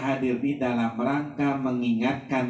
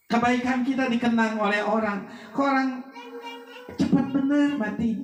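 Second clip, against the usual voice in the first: raised, emphatic sermon voice; fervent preaching; 10 semitones higher than usual. Acted. Preacher warning the funeral crowd, raised and emphatic. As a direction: drive the warning home to the mourners.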